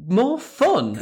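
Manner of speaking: high, mock incredulous query; imitated puzzled customer; baffled echo. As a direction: echo it back as if it makes no sense. Acted baffled customer querying slogan.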